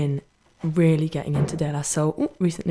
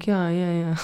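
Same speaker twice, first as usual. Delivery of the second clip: ordinary talk, nothing marked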